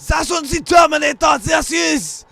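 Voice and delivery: high, very loud mock German; hammed-up German barking; German shout. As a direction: bellow it like a parody German film dub. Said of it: "He just goes".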